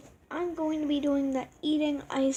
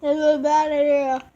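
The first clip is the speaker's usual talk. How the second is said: loud outburst; 8 dB louder than usual. Real, not acted. Ice cubes filling her mouth.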